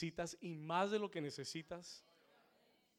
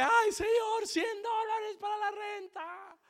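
very high, loud drawn-out wail; hammed-up shrieked plea to God; mock loud crying out; 11 dB louder than usual. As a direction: imitate a desperate man wailing at the altar. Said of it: "clamaba y clamaba duro en el altar".

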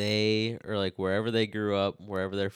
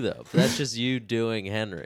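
ordinary delivery

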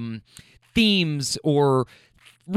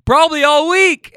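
sassy jab, much higher and louder; cheeky comeback; 11 semitones higher, 9 dB louder than usual. Retold or imitated. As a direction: toss off the zinger with a grin. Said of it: "I said it just like that".

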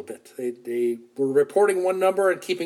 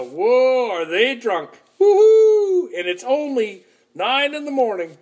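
louder, high mocking whoops; mocking crowd; 8 semitones higher, 6 dB louder than usual. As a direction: jeer like a crowd seeing drunks.

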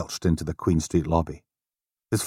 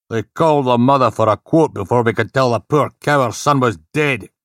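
angry inspector; 6 semitones higher, 6 dB louder than usual. Acted. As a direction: lay down the law angrily.

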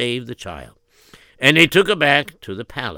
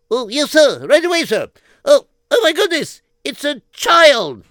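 high, loud eager soldier; 13 semitones higher, 6 dB louder than usual. Acted. Soldier finding a child in the snow, high and excited. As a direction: gasp it out like a startled young soldier.

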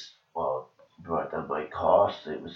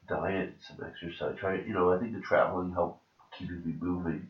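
ordinary talk

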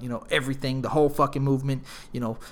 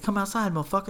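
higher-pitched mock challenge; retold angry challenge; 6 semitones higher, faster than usual. Friend challenges the bouncer to a fight, retold angrily. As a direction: call him out, retold.

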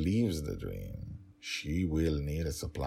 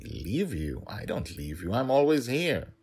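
confused and annoyed; 6 semitones higher, 4 dB louder than usual. Acted. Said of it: "half confused and half angry".

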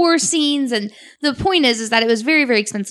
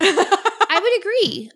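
laughing; 9 semitones higher, slower than usual. Real, not acted. Laughs at cohost's joke.

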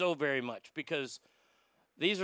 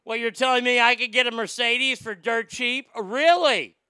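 loud phone bragger; 6 semitones higher, 7 dB louder than usual. Retold or imitated. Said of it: "People on cell phones".

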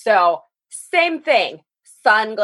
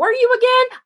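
mock clerk voice; 3 dB louder, faster than usual. Mocking dealership clerk.

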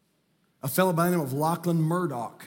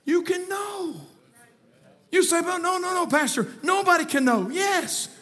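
high-pitched, emphatic pulpit delivery; impassioned high sermon voice; fervent preaching; 11 semitones higher than usual. Real, not acted. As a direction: proclaim it with full conviction to the congregation. Preaching at full force.

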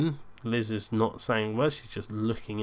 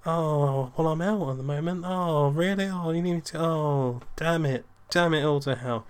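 put-on higher voice with drawn-in reactions; feigned dismay; 5 semitones higher than usual. Son on the phone faking bad news for his date, mock dismay. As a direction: play up the bad news for an eavesdropper. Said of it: "Liz can only hear one side of the conversation, so I'm doing".

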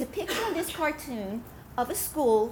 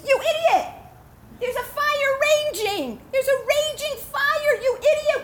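much higher, loud furious shout; furious yell; 10 semitones higher, 6 dB louder than usual. Acted. Landowner yelling at the innkeeper over the fire, furious.

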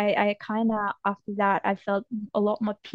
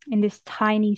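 ordinary talk

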